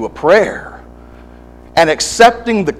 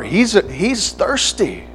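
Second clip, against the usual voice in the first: usual speaking voice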